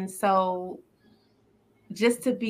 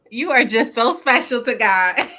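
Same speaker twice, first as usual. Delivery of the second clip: louder, gushing through a laugh; 2 semitones higher, 8 dB louder than usual. Real, not acted. To her co-host, praising her with a loud laugh. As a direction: beam it out and laugh.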